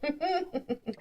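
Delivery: smug brag dissolving into laughter; cracking up mid-line; laughing. Acted. NPC brags, GM cracks up.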